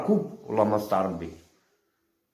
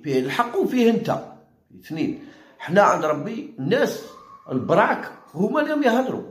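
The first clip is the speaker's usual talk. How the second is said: ordinary delivery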